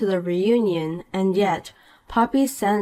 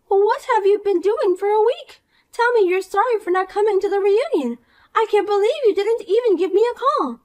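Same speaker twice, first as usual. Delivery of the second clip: put-on bright, higher voice of a smug mean girl; snide rich girl; 12 semitones higher, 3 dB louder than usual. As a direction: talk down to her with sweet contempt. Poppy demands apology.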